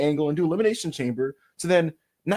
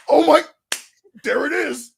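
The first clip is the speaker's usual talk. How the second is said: high, hammed-up fan gasping words; mock amazement; 9 semitones higher, 5 dB louder than usual. Imitated fan spotting the secret symbol, amazed. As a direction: exclaim it as a great discovery. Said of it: "and be like".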